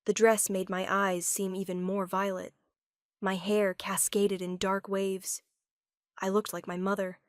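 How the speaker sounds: ordinary delivery, nothing marked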